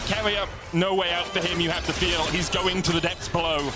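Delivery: normal talking voice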